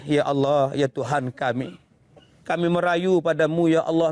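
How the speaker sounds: low, imploring lament to God; pleading prayer. Shown as a demonstration. Preacher shows how to beseech God, low pleading voice.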